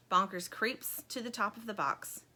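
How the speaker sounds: plain reading voice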